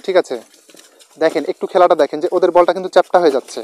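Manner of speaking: usual speaking voice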